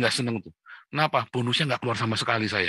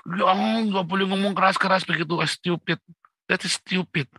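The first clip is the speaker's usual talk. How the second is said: higher-pitched mock objection; higher voice mocking the complainers; mimicked scolding critic; 6 semitones higher than usual. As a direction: imitate a disapproving critic, pitched up. Mimics critics objecting to loud speech.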